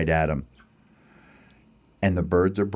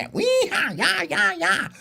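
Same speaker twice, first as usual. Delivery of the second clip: high playful yeehaw; cowboy yelling bird. Acted. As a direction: whoop like a rodeo rider, pitched way up. Birds pretend riding cat like horse.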